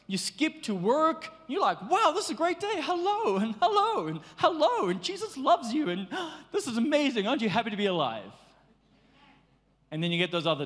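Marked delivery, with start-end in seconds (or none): 1.9-5.9 high, bubbly, laughing greetings; overjoyed mock cheer; giddy happy morning. 5.9-8.3 breathless delight. Acted. Happy morning voice acted out.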